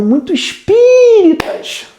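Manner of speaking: high, drawn-out pious parody; exaggerated singsong piety; mock religious voice. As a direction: stretch the stressed vowel up high like a syrupy churchgoer. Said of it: "Quem gosta de falar desse jeito são religiosos".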